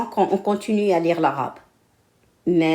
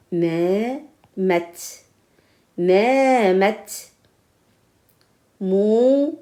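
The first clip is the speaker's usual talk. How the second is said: reading drill; 4 semitones higher, much slower than usual. Shown as a demonstration. Teacher reads drill sentences aloud for pupils. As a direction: read each syllable out for the class.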